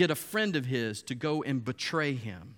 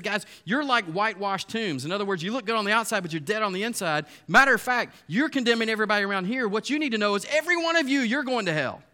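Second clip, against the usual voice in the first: higher, louder, fast scolding; rebuking preacher; 7 semitones higher, 6 dB louder than usual. Retold or imitated. Jesus confronting the religious leaders, higher, louder and fast. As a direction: tear into them with rising heat. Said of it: "and he said".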